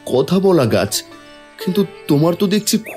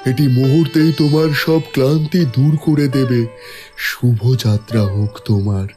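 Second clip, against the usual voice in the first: kindly tree giving a blessing; talking tree. Acted. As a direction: bless the traveller as a wise tree. Tree gives a magic fruit.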